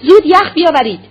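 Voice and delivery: loud, high-pitched shouted order; shouting. A narrator's reading. Doctor calling from inside the room, shouting. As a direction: call urgently across the rooms.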